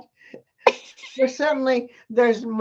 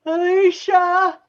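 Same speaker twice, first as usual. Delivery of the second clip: calling out; 8 semitones higher, 8 dB louder, slower than usual. Real, not acted. Calling out to tech person.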